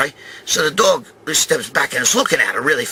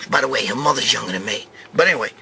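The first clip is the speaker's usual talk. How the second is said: ordinary talk, nothing marked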